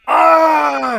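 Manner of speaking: sudden high fake scream; mock scream. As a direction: horror movie scream. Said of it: "just go, like, off screen and go".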